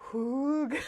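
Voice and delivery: Hoog with a long "oo"; taught pronunciation. Shown as a demonstration. Host teaching listeners the word, pronounced "hoog". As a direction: stretch the vowel in Hoog, laughing. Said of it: "learned together today that it is".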